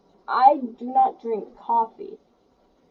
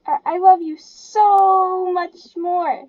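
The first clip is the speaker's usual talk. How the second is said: slow, whiny, half-crying gush; annoying voice; 8 semitones higher, 9 dB louder, slower than usual. Pretend. Mocking the couple behind her, slow fake-weepy whine. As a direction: drag it out in a fake weepy swoon. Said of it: "like, annoying type voice".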